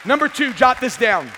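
high, forceful pulpit announcement; shouted sermon heading; loud preacher's call. Real, not acted. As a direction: call it out to the congregation. Preacher calls out next point.

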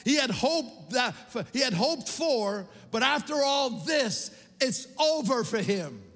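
high, driving sermon delivery; impassioned preaching. Real, not acted. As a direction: pile up the accusations, rising in pitch and force. Preaches Peter's failure, devil's case.

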